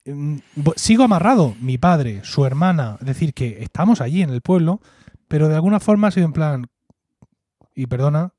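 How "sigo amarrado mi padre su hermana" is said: usual speaking voice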